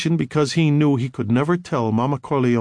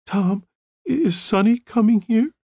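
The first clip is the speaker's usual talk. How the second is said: higher, scared and halting; frightened; 10 semitones higher than usual. A narrator's reading. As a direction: stammer it out in fear, pitched up. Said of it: "in a low, frightened voice".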